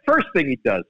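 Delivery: ordinary talk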